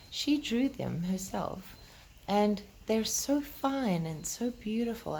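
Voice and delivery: plain reading voice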